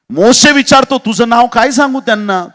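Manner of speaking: high, very loud dramatic question; forceful preaching. Acted. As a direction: thunder out the question from the pulpit. Preacher thunders Moses' question.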